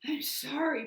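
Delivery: high, loud, winded apology; puffing, out of breath. A narrator's reading. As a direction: gasp it out, exhausted. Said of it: "puffed the dragon".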